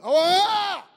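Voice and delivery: high, loud stung yelp; a sudden yelp of hurt pride; mock pained yelp. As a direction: yelp like you've just been hit. Reacting to sister's criticism.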